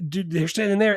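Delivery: normal talking voice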